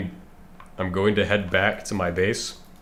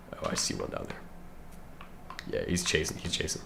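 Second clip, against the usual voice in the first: hushed whisper; 3 semitones lower, 10 dB quieter, faster than usual. Real, not acted. Watching enemy close in.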